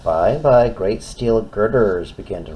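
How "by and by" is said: plain reading voice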